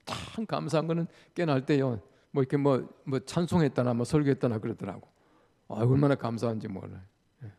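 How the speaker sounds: ordinary talk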